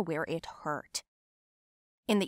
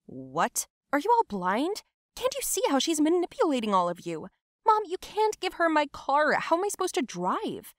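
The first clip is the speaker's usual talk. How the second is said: high-pitched, incredulous outburst; teenage outrage, pitched up; indignant protest; 7 semitones higher, 4 dB louder than usual. Acted. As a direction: protest indignantly to your mom, voice climbing high. Outburst at mother over car.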